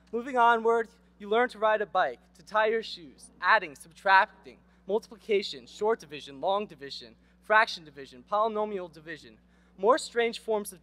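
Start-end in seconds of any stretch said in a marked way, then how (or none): none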